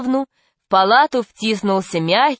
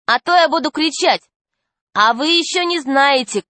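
higher-pitched, defiant warning; girl's threat; 6 semitones higher than usual. A narrator's reading. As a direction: warn them off boldly. Girl threatens to scream.

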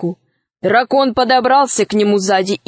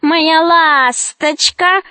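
drawn-out, higher witch call; Baba Yaga; 6 semitones higher, much slower than usual. A narrator's reading. Baba Yaga calls Alisa to shore, slow witch cry. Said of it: "закричала Баба Яга".

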